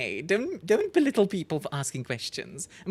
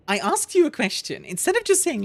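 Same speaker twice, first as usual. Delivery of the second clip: exasperated, louder and higher; annoyed; 10 semitones higher, 5 dB louder than usual. Real, not acted. Streamer venting at the rude alien, exasperated. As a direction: push it out in irritation. Venting about condescending character.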